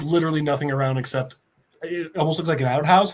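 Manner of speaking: plain reading voice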